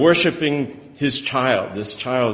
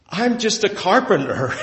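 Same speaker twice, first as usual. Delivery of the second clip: helpless carpenter; 8 semitones higher than usual. Retold or imitated. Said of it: "he's standing there going".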